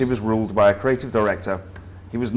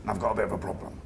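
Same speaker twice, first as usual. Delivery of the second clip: put-on lightly done comedy northern accent, a touch quieter; a hint of a gruff northern boss; 9 dB quieter, faster than usual. Creative director addressing the new grads, comedy northern accent. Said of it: "in his comedy northern accent".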